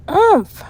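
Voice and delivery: loud high grunt of effort; grumbling grunt. A narrator's reading. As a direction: strain as if shoving something heavy. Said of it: "she grumbled".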